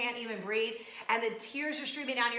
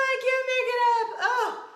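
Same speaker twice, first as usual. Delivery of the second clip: high, loud, quick squeal; real giddy outburst; hilarity squeal; 11 semitones higher, 7 dB louder than usual. Real, not acted. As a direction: squeal it out, overcome with laughter. Recovering from laughing fit.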